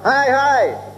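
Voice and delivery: high shouted hi hi; a farmer yelling to chase off a bull; shout to scare. Retold or imitated. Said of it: "he would scream out".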